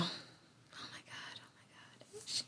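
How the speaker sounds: hushed gossip whisper; breathless whisper. Real, not acted. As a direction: whisper it like a juicy secret.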